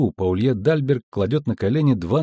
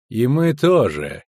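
ordinary delivery, nothing marked

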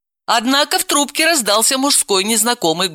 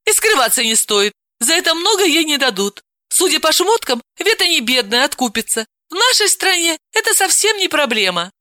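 higher, offhand mocking tone; dismissive; 10 semitones higher than usual. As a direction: wave it all away. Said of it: "небрежно махнула рукой".

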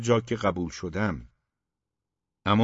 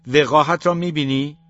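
loud, higher outraged shout; 4 semitones higher, 5 dB louder than usual. A narrator's reading. The father shouting to the mother about the son, outraged shout. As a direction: shout in outrage at the son's cheek. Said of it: "پدر فریاد زد".

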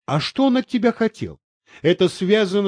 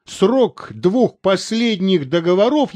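ordinary delivery

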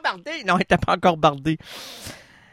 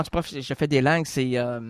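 ordinary talk

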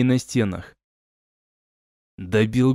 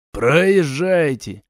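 calling out; 2 semitones higher, much slower than usual. A narrator's reading. Soldier on the wall waves travellers through, calling out. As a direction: stretch the word like a sentry's call. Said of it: "опять крикнул воин со стены".

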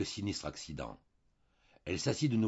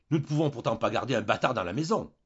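angry shout, higher and louder; cried out; 5 semitones higher, 8 dB louder than usual. A narrator's reading. Husband shouting at his wife over the bastard child, furious. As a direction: blow up at her as if losing his temper. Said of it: "il cria, comme s'il s'emportait".

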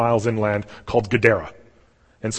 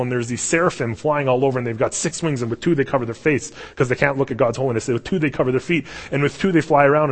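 usual speaking voice

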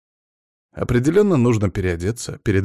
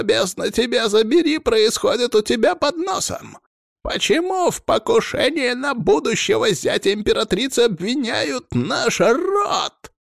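much higher, slow enraged voice down the phone; furious shout. A narrator's reading. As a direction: yell it down the line in fury. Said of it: "Прокричал в трубку разъярённый голос".

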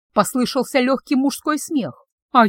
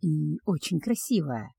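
much softer and lower, slow; quiet remark; 6 semitones lower, 8 dB quieter than usual. Acted. Betrothed quietly compliments the witch, soft and low. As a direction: say it tenderly under the breath. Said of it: "тихо заметил он".

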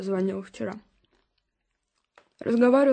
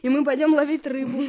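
laughing; 3 semitones higher than usual. Acted. Mother dreaming aloud, laughing.

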